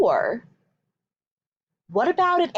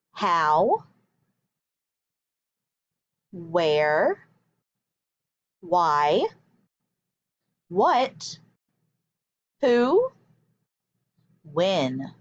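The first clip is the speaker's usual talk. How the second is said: each question word alone, spaced out for the class to echo; model words for repeating; 7 semitones lower, much slower than usual. Shown as a demonstration. Teacher leads her class in reading question words, each one spaced out. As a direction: say each word clearly and wait for them to repeat. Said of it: "Read them with me".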